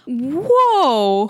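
high, drawn-out mock-stunned exclamation; retold shock. Retelling a reaction to the Skeksis having slaves, drawn-out shock. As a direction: stretch it out, exaggerating the shock.

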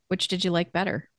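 ordinary delivery

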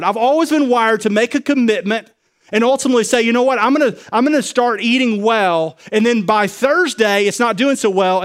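loud, high preaching voice. Acted. Pastor preaching to his church at full force. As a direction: drive it home to the congregation.

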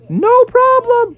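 very high falsetto surfer hero; jolly high-pitched bravado; Daniel Tiger. Acted. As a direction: whoop it up like a cartoon surfer dude. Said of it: "And he said".

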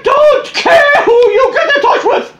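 high, slow, laughing mock rant; mock angry yelling. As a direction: yell it, cracking up. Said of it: "What's Daddy yelling about now?".

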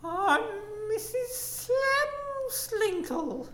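flirty woman voice. Acted. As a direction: greet them like a coquettish lady.

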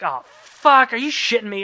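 mock outraged swearing, high and loud; natural cussing, acted. Host showing how normal people cuss, mock exasperation. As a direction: curse with easy, punchy exasperation. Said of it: "like, how people cuss".

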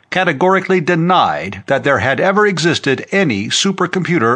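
brisk, emphatic newscast read; news commentator. Acted. Commentator introducing the general's recorded interview, news announcer voice.

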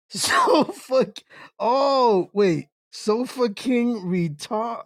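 giggling through the words, voice jumping high; cracking up as the pun lands; laughing while sounding it out. Real, not acted. Reading the chat name aloud, cracking up. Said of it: "Da-, damn".

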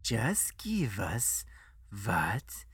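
put-on accented, sinking into slow, spaced-out words; cold menace. Financier pressures the refusing guest, coldly and very slowly. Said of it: "He said coldly".

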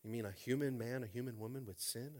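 ordinary talk

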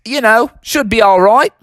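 high, hammed-up Aussie kangaroo; Australian accent. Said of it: "your Australian accent sure is awesome".

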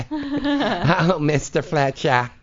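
high, laughing Long Island whine imitation; Fran Drescher nasal whine. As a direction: squeal it like the Nanny calling her boss. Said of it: "mimicking Fran Drescher's nanny voice".

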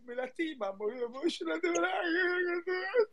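mock weeping played as if real; convincing fake crying. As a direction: sob out the hurt, pitch climbing to the end. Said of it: "Parece que lo hace en serio".

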